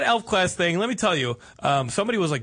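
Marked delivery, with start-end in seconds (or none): none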